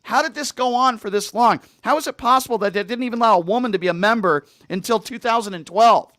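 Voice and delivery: serious rant. Real, not acted. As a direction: let the outrage rise in earnest. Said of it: "let me be serious here".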